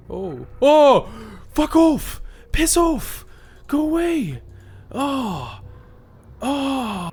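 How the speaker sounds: high, loud panicked grunts and yells; effort grunt. Real, not acted. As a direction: yell at the mobs in a panic. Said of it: "Sorry, I keep grunting".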